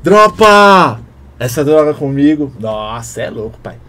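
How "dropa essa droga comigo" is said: slow, loud, off-rhythm "Dropa essa droga comigo"; off-beat delivery. Shown as a demonstration. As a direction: drag it out against the beat. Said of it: "parece que ele fala fora do ritmo". (fora do ritmo, "Dropa" lento e forte)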